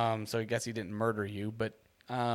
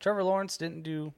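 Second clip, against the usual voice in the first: ordinary delivery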